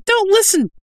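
very high, exasperated objector; protesting interruption. Acted. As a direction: break in with a squeal of protest. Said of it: "Lomo broke in".

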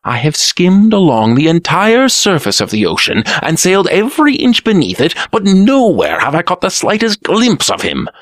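lightly voiced whining prince; Green Prince voice. A narrator's reading.

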